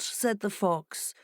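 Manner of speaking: plain reading voice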